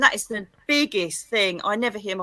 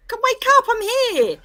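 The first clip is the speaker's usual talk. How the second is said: much higher, louder coaxing call; spirit calling; 14 semitones higher, 7 dB louder than usual. Pretend. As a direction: call her awake like a playful spirit. Voicing the spirit waking daughter.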